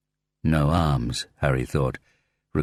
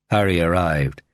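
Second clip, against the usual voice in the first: ordinary delivery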